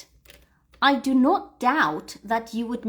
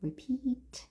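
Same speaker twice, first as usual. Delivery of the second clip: whisper; 4 semitones higher, 10 dB quieter than usual. Real, not acted. Whispered prompt.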